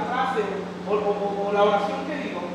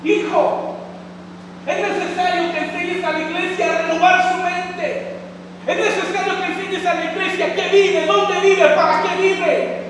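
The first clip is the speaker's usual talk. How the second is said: voice of the Spirit; 6 semitones higher, 3 dB louder than usual. Retold or imitated. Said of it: "El Espíritu Santo me decía".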